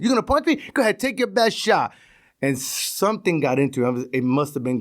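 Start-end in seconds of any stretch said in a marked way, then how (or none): 0.0-1.9 high-pitched taunting challenge; imitating a cocky bully; goading dare. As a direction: egg him on, daring. Retold cop daring him to punch.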